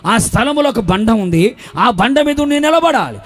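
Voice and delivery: driving, insistent sermon delivery; full-force preaching. Real, not acted. Preacher warning a church without rebukers, full-force preaching. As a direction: hammer out each phrase like a preacher.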